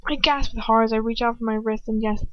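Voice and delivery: plain reading voice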